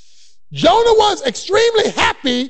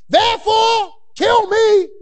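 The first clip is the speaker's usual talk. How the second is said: anguished cry; 8 semitones higher, 4 dB louder, slower than usual. Acted. Jonah's plea read out.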